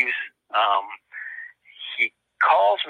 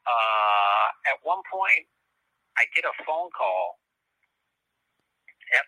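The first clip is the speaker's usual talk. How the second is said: ordinary talk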